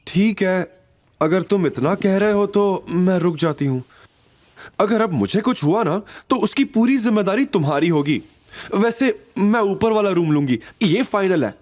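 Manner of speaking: put-on high, playful young woman setting terms; Avantika agreeing. A narrator's reading. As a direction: tease him while giving in. Narrator voices Avantika agreeing. (ऊँची आवाज़ में अवंतिका, शरारत से शर्तें रखती)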